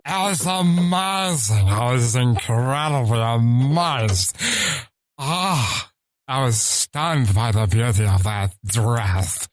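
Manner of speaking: slow, swooping put-on gush; gushing character voice. Shown as a demonstration. Amazed by a dress, gushing put-on voice. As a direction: gush in drawn-out mock amazement.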